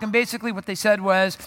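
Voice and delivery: ordinary delivery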